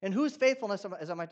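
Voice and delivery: normal talking voice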